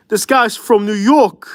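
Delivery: put-on high, drawn-out New York accent. Saying it on request, in a mock New York accent. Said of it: "He wants me to hear".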